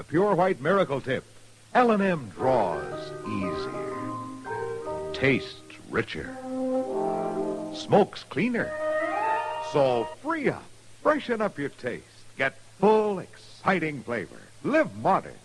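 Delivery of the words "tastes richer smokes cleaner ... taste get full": ordinary talk, nothing marked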